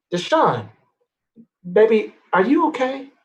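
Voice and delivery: put-on a mama checking on her sick child; worried mama. Worried mother checks on son.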